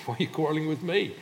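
high, quick indignant comeback; exasperated retort. Acted. Moses answers the quarrelling people, exasperated retort. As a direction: fire the question back in protest.

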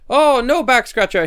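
high, loud mock refusal; kitchen lady. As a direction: play along with a hammy sing-out denial. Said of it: "And she goes".

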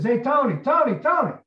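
loud, high shout for someone's attention; acting out a shouted summons, name repeated; calling out. Friend calling Tony out of the shower, high shout.

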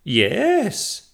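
high, loud witch's yes; drawn-out, high-pitched cry of agreement. A narrator's reading. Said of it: "cried the witch".

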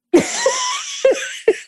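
a high squeal of a laugh. Real, not acted. To her cohost after the speech, a high squealing laugh. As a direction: let a high laugh fly up.

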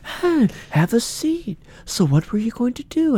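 calm, high-pitched impression of a TV interrogator; Chris Hansen. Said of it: "he talks all calm".

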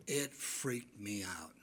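slow, soft whispered confession; a spooked, slow whisper to the church; hushed whisper. Real, not acted. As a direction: let it out under your breath. Recalling the woman's death.

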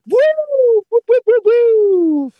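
high whooping police siren; siren imitation.

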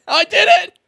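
high, loud giddy announcement; excited announcement. Retold or imitated. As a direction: blurt it out proudly to the housemate. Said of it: "went out to my housemate and was like".

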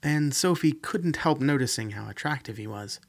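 ordinary delivery

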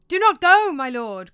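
very loud, high warning; alarmed shout, easing at the end; fearful cry. A narrator's reading. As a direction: cry out in fear to stop someone. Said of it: "cried Marcellus in fear".

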